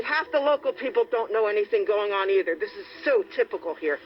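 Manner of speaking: normal talking voice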